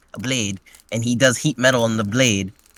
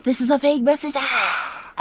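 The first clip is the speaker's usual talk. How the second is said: much higher, squeaky put-on goddess voice; squeaky divine voice; 13 semitones higher than usual. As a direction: squeak it like a silly deity ending on a yelp. Goddess voice quoted in recap.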